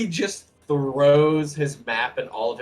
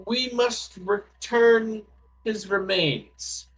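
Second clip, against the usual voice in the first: lightly voiced wizard; 6 semitones higher, 3 dB louder than usual. Dwarf wizard explains the plan to the cleric, lightly voiced. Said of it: "The wizard is going to pipe in".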